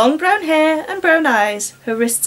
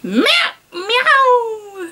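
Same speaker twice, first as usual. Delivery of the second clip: catlike meows; 10 semitones higher than usual. A narrator's reading. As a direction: meow like a pleased vampire cat. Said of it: "said Farilla".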